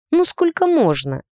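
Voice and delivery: stung and fed up; offended. A narrator's reading. Heroine protests yet another jab at her age, offended. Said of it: "заметила обиженно".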